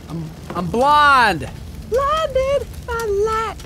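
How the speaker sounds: slow, high mock wail; hammed-up blindness. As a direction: ham up being blinded. Screen flames blind him.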